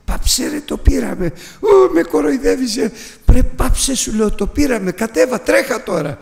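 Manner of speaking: high, loud telling-off; husband's loud rebuke while fleeing; excited shout. Retold or imitated. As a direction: shout over the panic to hush her. Husband snaps back at wife.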